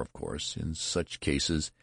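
ordinary delivery, nothing marked

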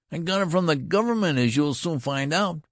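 high, loud treble retort; indignant boy. A narrator's reading. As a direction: defy the colonel in a boyish treble. Boy retorts to doubting colonel.

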